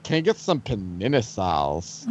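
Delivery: low, soft, lips barely moving; talking with mouth closed. Retold or imitated. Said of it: "you say it without moving your lips".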